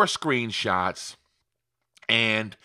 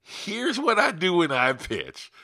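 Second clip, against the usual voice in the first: higher and slow, so the room leans in; dramatic pause-filled pitch line; 6 semitones higher than usual. Shown as a demonstration. Sales coach to newbies, slow and higher weighty teaser. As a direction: deliver it like the E F Hutton ad everyone stops for. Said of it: "Everybody should be leaning in right now".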